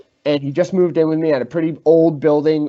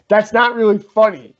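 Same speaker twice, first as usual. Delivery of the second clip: higher, louder mock protest; annoyed roommate; 6 semitones higher, 6 dB louder than usual. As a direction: imitate an irritated complaint, raised voice. Retelling roommate's annoyed complaint.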